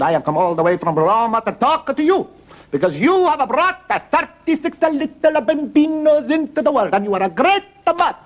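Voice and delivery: high, hammed-up Italian accent; Holy Father. Pope praising the mother of thirty-six, mock Italian accent. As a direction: proclaim it grandly in mock Italian. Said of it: "That's the way he talks".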